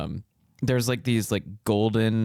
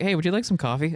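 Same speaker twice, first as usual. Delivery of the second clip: bright offer; 9 semitones higher than usual. Pretend. Acting an offer in skit.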